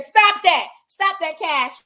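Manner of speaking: sharp loud scold at a biting puppy; scolding dog. Real, not acted. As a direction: snap it out to stop the dog.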